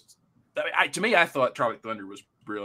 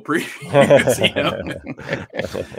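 broken up by laughter; laughing; 6 dB louder, much slower than usual. Real, not acted. Recalling fake previews in the theater, laughing. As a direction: say it through a laugh.

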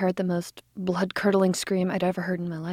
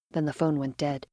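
ordinary delivery, nothing marked

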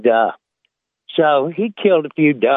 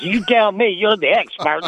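higher, sly punchline delivery; a smug hillbilly fires back at the warden, pitched up; cheeky hillbilly; 6 semitones higher than usual. Acted. A hillbilly sasses the game warden at the punchline, cheeky. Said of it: "Hillbilly drops his overalls and bends over and says".

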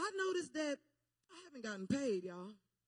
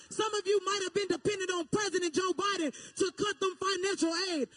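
full-force preaching; 6 semitones higher, 6 dB louder than usual. Real, not acted. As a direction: drive it home to the congregation, building to a shout. Preaches to the congregation.

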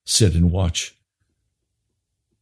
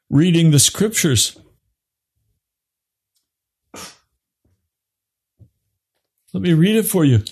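higher, driving sermon voice; fiery preaching; 10 semitones higher than usual. Acted. Preacher warning his congregation, fiery high preaching. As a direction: preach it hard, building to the altar call.

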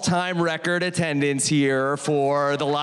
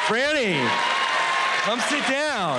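excited welcome; 8 semitones higher than usual. Real, not acted. Host welcomes guest on stage.

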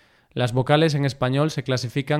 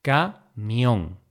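camión split into ca, mión; syllable by syllable. Shown as a demonstration. Teacher dividing camión for learners, syllable by syllable. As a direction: say "camión" with a pause after ca.

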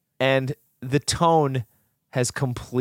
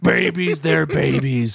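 mock scream; slower than usual.

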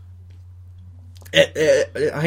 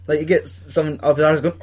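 ordinary talk

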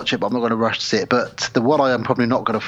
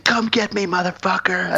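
high, put-on movie one-liner; mock action heroine; 8 semitones higher than usual. Mocking the trailer heroine's line, high and hammed-up. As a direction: throw out a hammed-up heroine's challenge. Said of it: "She's like".

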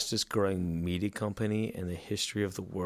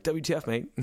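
mock Australian; 7 semitones higher than usual. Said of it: "Australia was like".

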